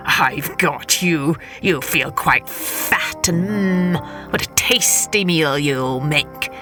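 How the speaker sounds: low, slow, gloating trickster; sly Coyote voice. Acted. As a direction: savour every word like a hungry coyote. Coyote gloats over caught Hare.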